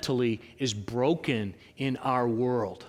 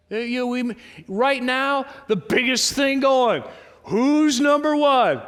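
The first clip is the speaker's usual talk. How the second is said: hyped-up shout; 8 semitones higher, 6 dB louder than usual. Acted. Preacher mocking the crowd's obsession with rankings, hyped shout. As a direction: pump it up like a sports fan chanting the ranking. Mimicking the hype over rankings.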